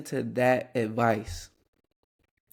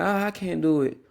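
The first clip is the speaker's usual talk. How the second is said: higher-pitched mock complaint; imitated whine; 6 semitones higher than usual. Imitating his little brother giving up, mock whine.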